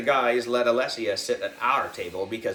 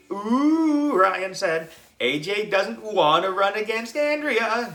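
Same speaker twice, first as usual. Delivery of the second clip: teasing; 10 semitones higher, 5 dB louder than usual. A narrator's reading. Ryan teasing AJ.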